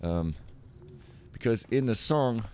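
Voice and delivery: ordinary delivery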